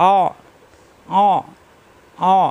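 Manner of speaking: অ squeezed in the throat as Arabic ain; pressed ain. Shown as a demonstration. Teacher drilling hamza joined to ain, অ squeezed in the throat. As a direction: press the throat hard on each অ. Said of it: "আইন কণ্ঠনালীতে চাপ".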